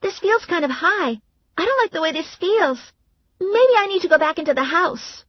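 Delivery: high, timid voice; nervous up on the roof; scared sister. Acted. Valerie frightened at the roof edge, high timid voice.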